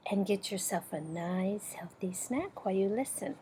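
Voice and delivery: soft, quick whispered aside. Real, not acted. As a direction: hush it like a friendly tip. Aside suggesting a snack.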